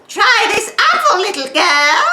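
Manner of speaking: put-on cackle-bright witch luring a girl; nasal witch voice. Shown as a demonstration. Teacher demos a witch through the nose, high and loud. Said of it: "I'll give you an example".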